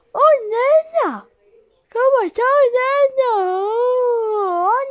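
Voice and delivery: jolted by a jump scare, cry out; startled shout. Real, not acted. Player startled by something on screen, shouting loudly in alarm.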